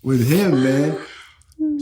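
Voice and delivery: high, laughing announcement. Real, not acted. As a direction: laugh through the segue.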